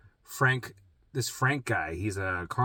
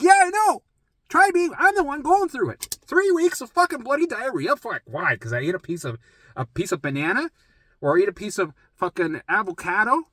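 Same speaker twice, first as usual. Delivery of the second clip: 1 frustrated rant; 11 semitones higher, 6 dB louder than usual. Retold or imitated. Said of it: "I'm like".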